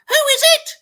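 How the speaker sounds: very high, loud fake granny shriek; shrill quivering shriek. Wolf faking Grandma's voice for the visitor. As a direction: shriek like a frail old granny. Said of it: "I shrieked, with a shrill quiver".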